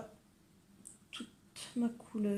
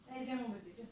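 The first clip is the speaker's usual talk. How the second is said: doux et plus bas, comme à un bébé; câlin au chat; 10 dB quieter, much faster than usual. Real, not acted. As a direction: amadoue le chat tout doucement. Cajole son chat à la porte. (voix douce et câline pour son chat)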